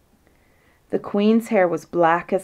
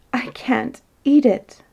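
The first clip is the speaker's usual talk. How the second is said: higher, slow rueful wail; 6 semitones higher than usual. A narrator's reading. Queen crying over her honey, rueful and higher. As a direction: complain slowly and pitifully. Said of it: "looking ruefully at the honey pot".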